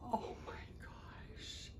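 slow hushed whisper; awed whisper. Real, not acted. Vlogger seeing a little romper, whispering. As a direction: whisper it, overcome.

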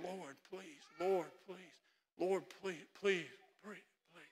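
almost no voice, just breathing the words; silent prayer. Acted. Hannah praying silently in the temple, near-voiceless pleading. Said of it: "nobody can hear words coming from her mouth".

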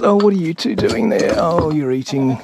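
talking to pets. Real, not acted. Speaking to two animals found eating.

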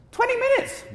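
high-pitched shocked yelp; shocked disbelief. Real, not acted. Lecturer learning how little time is left, shocked.

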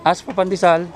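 ordinary delivery

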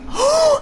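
high frightened gasp; sharp gasp. Real, not acted. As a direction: suck in air in shock. Monster appears suddenly.

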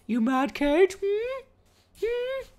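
very high-pitched, squeaky needling; teasing. Pretend. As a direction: tease with questioning high hums. Teasing jealous Kate.

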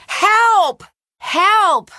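loud, high cry for help; shouting for help. Acted. Stranded player calling for rescue, loud high shout. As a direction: yell it out so someone hears you.

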